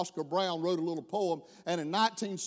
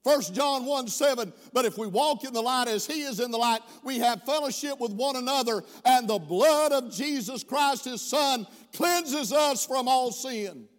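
louder, higher chanted scripture reading; preached scripture; 5 semitones higher, 5 dB louder than usual. Real, not acted. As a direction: intone the verse over the music.

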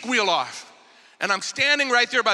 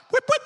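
high-pitched mimicked unlock beep; imitated car chirp. Storyteller mimics his wife's car unlocking, high imitated chirp.

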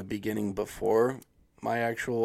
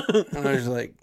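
usual speaking voice

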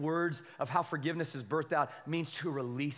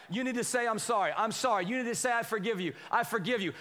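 raised-pitch mock parental prompting; higher-pitched imitation of a parent's orders; parent prompting kids; 6 semitones higher than usual. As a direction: lightly act out coaching children through an apology. Parent prompting kids' forced apologies.

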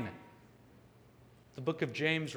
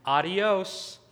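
higher, louder mocking farewell; cheeky, laughing; 5 semitones higher, 5 dB louder than usual. Defendant walking away from the judge, cheeky mock farewell. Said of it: "while laughing, just the words".

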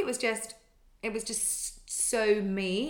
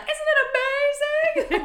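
very high, loud and slow mock gush; 10 dB louder, slower than usual. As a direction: stretch it out like an over-thrilled fan. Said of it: "They're like".

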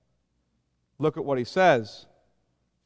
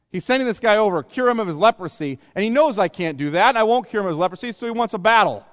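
raised, louder, agitated protest; higher and louder, as if affronted; indignant king; 6 semitones higher, 6 dB louder than usual. Acted. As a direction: act out a king who suspects he's being provoked. Acted indignant king reading a hostile letter.

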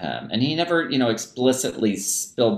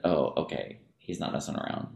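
softer, half-whispered realization; quiet dawning realization; 6 semitones lower, 9 dB quieter than usual. Retold or imitated. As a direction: let it sink in under your breath. Students' dawning realization voiced.

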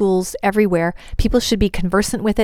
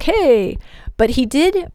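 higher, drawn-out objecting "hey"; mock protest; 6 semitones higher, slower than usual. Guys today protesting being left out, mock protest. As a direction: object like a slighted guy. Mimics men feeling left out.

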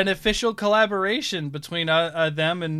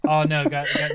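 ordinary talk, nothing marked

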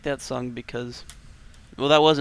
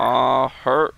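put-on country accent; 7 dB louder than usual. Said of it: "in some really bad country accent".

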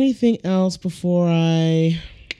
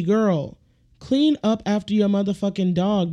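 normal talking voice

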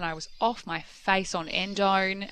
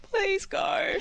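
much higher crying shout of please go; hammed-up high sobbing yell; wailing plea; 13 semitones higher than usual. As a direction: beg through mock tears. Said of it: "And I was like".

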